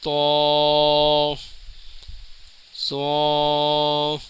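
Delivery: تو and سو each held long and rounded; drawn-out letters. Shown as a demonstration. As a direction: stretch تو, pause, then stretch سو. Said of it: "ہلکا سا کھینچ کے پڑھیں گے".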